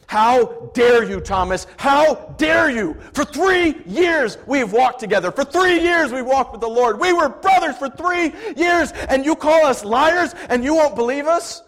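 high, loud, slow furious shout; angry. Acted. Peter shouting at Thomas in fury, loud. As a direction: roar at him in outrage. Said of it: "he was angry".

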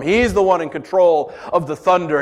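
ordinary delivery

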